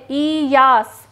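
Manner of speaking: Yash drawn out slowly in two parts, ई then यास; slow syllable-split repeat. Shown as a demonstration. Teacher repeating a new term for pupils, Yash slowly broken into syllables. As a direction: stretch the first vowel of Yash, then finish यास.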